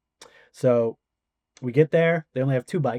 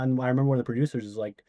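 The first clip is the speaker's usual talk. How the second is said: ordinary talk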